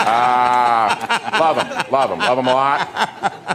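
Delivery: mock eulogy through imagined gum; casual gum-chewer's short phrases, lightly imitated; Jason Sudeikis. Co-star giving a eulogy, hint of chewing gum. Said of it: "trying to give a moving eulogy".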